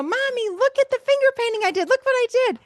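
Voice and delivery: put-on high, quick excited child voice; excited little kid. Little kid showing mom a finger painting, high excited child voice. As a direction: imitate a thrilled kid calling for attention. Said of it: "you're really excited and you did finger painting".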